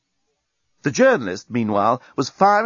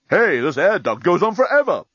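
delighted; 6 semitones higher than usual. A narrator's reading. Dan delighted in VR.

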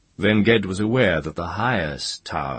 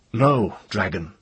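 high, firm refusal; short, ringing rebuff; defiant young wizard; 4 semitones higher, 4 dB louder than usual. A narrator's reading. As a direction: answer the dragon boldly, pitch raised. Ged again refuses the dragon.

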